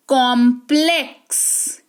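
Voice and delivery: slow कॉम्प्लेक्स ending in a clear ks. Shown as a demonstration. Teacher showing X's sound, slow कॉम्प्लेक्स ending in ks. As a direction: draw out कॉम्प्लेक्स, finishing half k into s. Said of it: "एक्स का उच्चारण आधा क और स".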